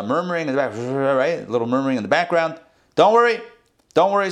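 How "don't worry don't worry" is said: high, loud hasty don't worry; reassuring fox. Retold or imitated. Fox soothing the nervous animals, high and eager. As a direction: hurry to calm the nervous animals. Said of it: "says the fox".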